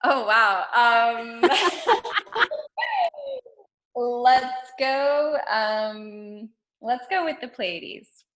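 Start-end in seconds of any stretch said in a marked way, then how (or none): 1.5-3.5 very high-pitched giggling fit; laughing. Real, not acted. Guest caught by a quick-fire question, laughing. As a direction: giggle helplessly before answering.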